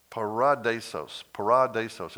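"paradisos" stressed on its second syllable, pa-RA-di-sos. Shown as a demonstration. Preacher showing the Greek word, second-syllable stress on "paradisos". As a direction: say "paradisos" carefully, leaning on the RA. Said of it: "It's the second syllable".

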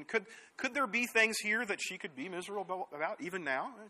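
ordinary delivery

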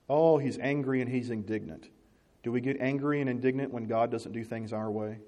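usual speaking voice

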